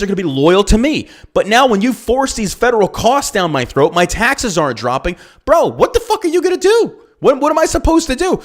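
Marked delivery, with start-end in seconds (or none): 5.4-8.4 high, incredulous rant; high-pitched exasperation; exasperated outburst. Real, not acted. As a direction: throw up your hands, what am I supposed to do.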